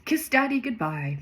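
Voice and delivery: high-pitched, half-hearted storybook yell; lightly acted parting call; mild yell. A narrator's reading. Father calling goodbye to his children, mild mock yell. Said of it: "he yells".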